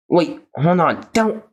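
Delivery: rising high panicked plea. Acted. Jax cornered by Zubul, pleading in rising panic. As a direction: back away begging.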